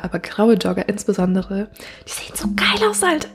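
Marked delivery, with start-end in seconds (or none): 2.1-3.3 high-pitched swooning squeal; thrilled, much higher pitch; gushing. Real, not acted. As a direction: gush in a voice shooting way up. Gushing over grey joggers.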